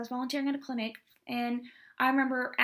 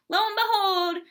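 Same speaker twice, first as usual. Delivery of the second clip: dramatic reveal; 8 semitones higher, 8 dB louder than usual. Real, not acted.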